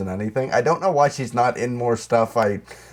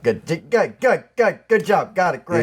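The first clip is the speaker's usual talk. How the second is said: mock excited praise; 7 semitones higher than usual. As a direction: rattle off the praise like a giddy crew. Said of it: "everybody in the production team like".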